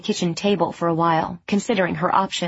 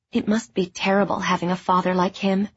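usual speaking voice

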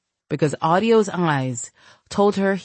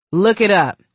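mildly angry, louder parting shot; curt, louder dismissal; 3 semitones higher, 5 dB louder than usual. A narrator's reading. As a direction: toss it out loudly on the way out. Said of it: "he said angrily as he exited".

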